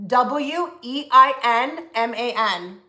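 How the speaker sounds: slow letter-by-letter spelling. Shown as a demonstration. Giving viewers the healer's last name, spelled out slowly. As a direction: spell it out slowly for note-takers.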